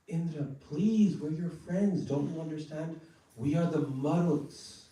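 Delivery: high, pleading cry. Retold or imitated. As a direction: beg Indra as crying babies. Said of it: "they're all crying".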